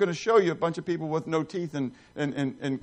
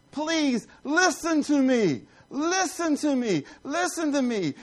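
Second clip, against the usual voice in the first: Wisdom crying out; 8 semitones higher, slower than usual. Acted. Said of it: "Wisdom is crying out publicly".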